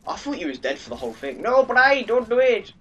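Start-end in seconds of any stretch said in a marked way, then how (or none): 1.4-2.7 high, loud cry of no, don't; loud plea. Real, not acted. Calling out to a teammate in the match, loud high plea. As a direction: yell at Bri not to do it.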